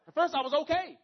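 high, loud mock outrage; sudden loud outburst. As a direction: blurt it out in jealous mock anger. Mock anger at friend's bigger plant.

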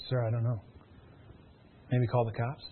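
low, soft, helpless reply; mock a clerk quietly washing their hands of it; retold unhelpful clerk. Store agent retold, unable to help.

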